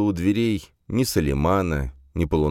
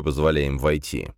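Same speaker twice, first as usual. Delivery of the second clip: ordinary delivery, nothing marked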